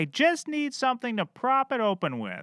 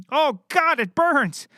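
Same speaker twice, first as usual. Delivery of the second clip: cough, then a loud, higher cry of "it burns"; hammed-up choking; 5 semitones higher, 3 dB louder, slower than usual. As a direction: overplay the burning drink. Detective chokes on Gatorade.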